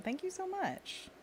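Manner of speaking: high, slow squeaky thank-you; high silly voice. Pretend.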